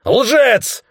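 very high, loud shout. A narrator's reading. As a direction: spit the accusation at full volume. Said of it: "закричал господин Тагоми".

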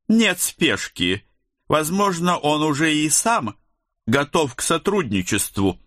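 high-pitched female official's voice; committee woman. A narrator's reading. Committee lady to her colleague about questioning the officer, high-pitched official voice.

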